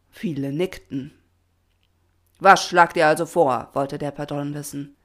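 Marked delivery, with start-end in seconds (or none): 2.4-3.7 loud challenge to the crowd; commanding question. A narrator's reading. Patron asks the protesters for ideas, loud question. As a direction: demand a proposal from them.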